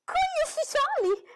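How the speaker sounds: very high, loud, slow shocked cry; surprised exclaim. A narrator's reading. Girl shocked at the letter's news, very high. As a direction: gasp it out in disbelief. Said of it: "esclamò Lydia".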